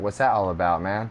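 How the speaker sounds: laid-back California "about, man"; lightly voiced California accent. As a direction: imitate a Californian, plain open "about". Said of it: "when someone from California hears someone from Canada".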